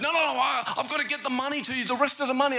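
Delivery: high, hurried imitation of a flustered shopper; insistent protest. Shopper insisting she repay the stranger, flustered.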